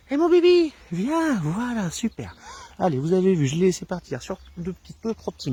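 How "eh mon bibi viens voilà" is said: much higher and louder, sing-song call to the puppy; high-pitched joyful dog recall; high happy recall voice. Shown as a demonstration. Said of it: "l'intonation de ma voix, donc une voix aiguë".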